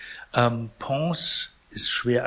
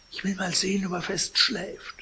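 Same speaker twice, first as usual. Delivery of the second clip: much higher, light character voice; female character voice; 9 semitones higher than usual. A narrator's reading. Portress goes to check on the sleeper, high-voiced character. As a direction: lift the pitch well up for the portress.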